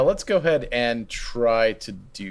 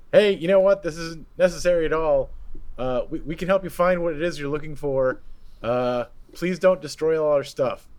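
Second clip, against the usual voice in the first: raised, higher-pitched yell, only half in earnest; mock yelling; 5 semitones higher than usual. Player voicing a character heard above the din, mock yelling. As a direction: pretend to yell over a din. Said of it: "I'm just gonna be yelling".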